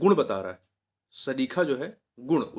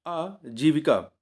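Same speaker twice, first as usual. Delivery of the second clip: आजीविका drawn out slowly, every syllable clear; slow teacher's dictation; slow careful spelling-out; slower than usual. Shown as a demonstration. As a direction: say आजीविका slowly so it can be written down. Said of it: "देखिए आजीविका लिखा है".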